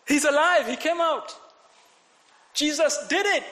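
preacher at the peak of a sermon; excited proclamation. Real, not acted. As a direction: announce the miracle in triumph. Preacher's climax of resurrection.

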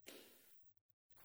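breathy sarcastic whisper; whispered aside. Real, not acted. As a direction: drop to a knowing whisper. Sarcastic aside on false charge.

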